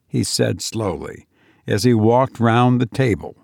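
ordinary delivery

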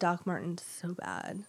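soft whispered confession; whispering. Real, not acted. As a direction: whisper it like a secret. Confessing old longing.

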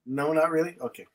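normal talking voice

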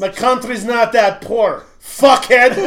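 put-on loud, high, slow Russian-accented outburst; indignant Russian roommate. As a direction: hurl it out in a mock-offended Russian accent. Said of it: "And he goes".

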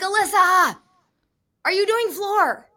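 high, drawn-out yell across a gym; loud calling out. Retold or imitated. Commentator yelling to gymnast across the way, very loud. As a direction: holler the question to someone far away. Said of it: "I said it very loud across the way".

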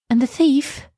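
put-on high, rising girl's question; Elsa asking. A narrator's reading. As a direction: ask anxiously after the last man. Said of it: "asked Elsa".

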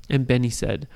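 ordinary delivery, nothing marked

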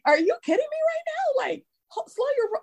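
very high, exasperated disbelief; mock indignant. Cashier teacher scolding pushy kid customers, mock indignant. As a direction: play up the outrage. Indignant kids at play store.

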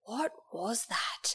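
slow, hushed whisper; whispered disbelief. Retold or imitated. Son in the car asking mum, in a hushed whisper. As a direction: whisper it, stunned. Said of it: "he's like".

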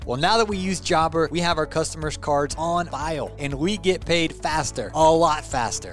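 loud, energetic sponsor read; upbeat ad read. Acted. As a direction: sell it like a radio spot. Reads a sponsor ad.

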